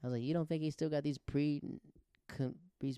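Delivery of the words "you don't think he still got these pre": normal talking voice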